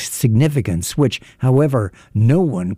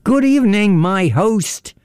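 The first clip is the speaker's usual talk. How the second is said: high-pitched, boisterous salutation; hearty greeting; 9 semitones higher than usual. A narrator's reading. Intruder greets his unwilling host, boisterous and high. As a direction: sing out the greeting high and drawn.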